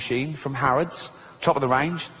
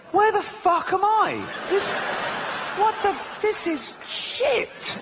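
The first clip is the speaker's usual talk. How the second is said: goat voice; slower than usual. Retold or imitated. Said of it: "The goat is going".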